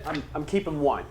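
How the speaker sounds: ordinary talk, nothing marked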